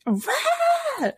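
very high mock scream, "rah"; imitated child scream. Mom imitating Charlie screaming at her feet, mock scream. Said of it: "Charlie starts screaming ... like".

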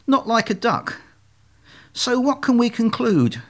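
lightly voiced, high-pitched reasoning; insistent leader swan. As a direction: swan leader building to the obvious conclusion. Leader swan sums up the lesson.

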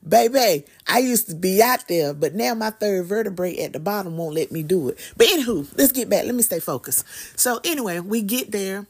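ordinary talk, nothing marked

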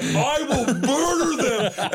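high, drawn-out mock mobster fury; screaming threat. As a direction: imitate an enraged lawyer vowing revenge. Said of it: "And he's screaming".

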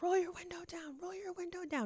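high-pitched imitation of a man calling out; stranger calling over. Man calling to a driver from the next car, imitated urgent call.

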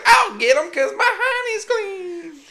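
high, slow, laughing through it; laughing mock bear voice. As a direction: try to say the ad line while cracking up. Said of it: "he's like".

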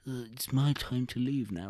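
lightly voiced old man, slowed; mock the grandad, creaky and slow; a hint of an old man taking his leave. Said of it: "I'm gonna cosplay as the old man".

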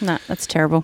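ordinary talk

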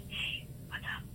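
hushed whisper in a library; whispering on the phone. Real, not acted. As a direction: keep it to a whisper. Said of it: "Why are you whispering?".

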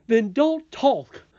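high, loud, slow retort; exasperated nurse. A narrator's reading. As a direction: cut the child off in exasperation, words drawn out. Nurse snaps at chattering princess.

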